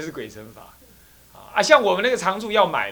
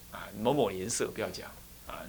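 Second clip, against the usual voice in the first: ordinary talk, nothing marked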